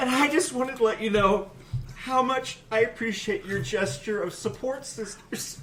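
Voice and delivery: high, choked-up gushing; mock emotional. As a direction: overcome with exaggerated gratitude. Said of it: "getting so emotional".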